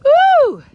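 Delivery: loud high whoop; joyful whoop. Real, not acted. Joyful cry.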